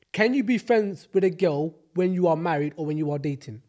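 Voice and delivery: ordinary talk, nothing marked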